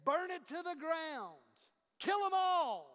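rising to a loud, high, savage shout; enemy war cry. Acted. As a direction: bellow it like a bloodthirsty mob. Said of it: "And these Edomites said".

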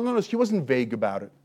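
ordinary talk

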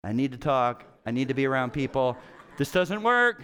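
ordinary talk

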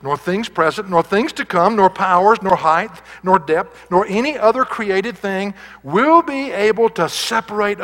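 building preaching climax. Acted. Preacher to his congregation, a building climax.

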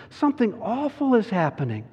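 put-on high, anxious girl's voice; acted alarm, pitched high; alarmed Susan. A narrator's reading. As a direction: voice a girl character in dread. Frightened Susan in the story.

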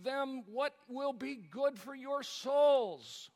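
loud, slow, ringing pulpit climax; preaching at full force. Acted. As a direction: drive it home to the congregation, drawn out.

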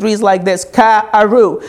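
usual speaking voice

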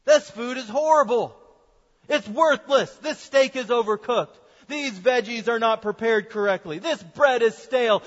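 loud, scornful rant about the meal; blunt dramatic food critique. Retold or imitated. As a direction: tear into the dinner with disgust. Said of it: "ripping into it like this".

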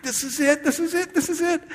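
very high, breathless-crowd excitement, acted; excited followers. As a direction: chant it in mounting mock anticipation. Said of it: "They're like".

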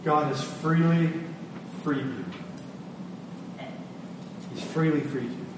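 usual speaking voice

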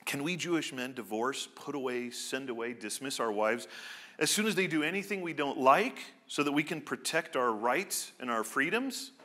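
ordinary talk, nothing marked

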